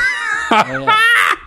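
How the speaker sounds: high burst of laughter; real laughter. Real, not acted. Host laughing at own British impression, high cackle.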